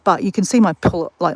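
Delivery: normal talking voice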